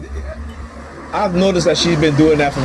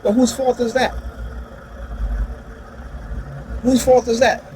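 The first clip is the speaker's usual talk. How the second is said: higher, pointed rhetorical question; indignant; 9 semitones higher, 3 dB quieter than usual. Real, not acted. Vlogger venting about his ex to viewers, indignant. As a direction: demand an answer, exasperated.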